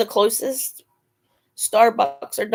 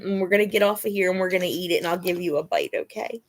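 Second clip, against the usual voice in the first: ordinary talk, nothing marked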